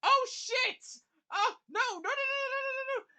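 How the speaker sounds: high, loud frantic cries of no; a man watching his friend in danger; panicked shouting. Acted. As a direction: shriek it in sheer panic. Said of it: "just says".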